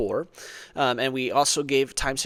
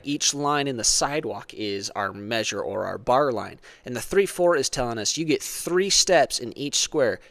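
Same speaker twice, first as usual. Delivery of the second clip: ordinary delivery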